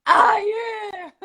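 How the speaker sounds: loud, high whoop of joy; excited cheer. Real, not acted. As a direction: cheer with a laugh. Winner finally appears.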